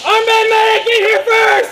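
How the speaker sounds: high, loud desperate call; shouting for a medic. Real, not acted. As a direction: yell urgently for help. Calling medic in game.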